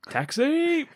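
high, sudden taxi-hailing call. Acted. Acted hailing of a cab.

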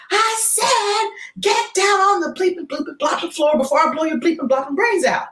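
high, strained threatening yell; mock robber shouting at the crowd; robber's threat. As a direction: bark the threat at the whole bank. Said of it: "So he says".

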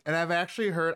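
normal talking voice

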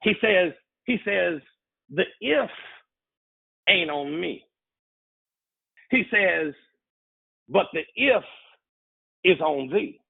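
high-pitched, deliberate delivery with long pauses; slowed-down preaching. Real, not acted. Preacher slowing down so the congregation gets it, slow and deliberate. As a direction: let each phrase hang before the next. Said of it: "Let me slow down".

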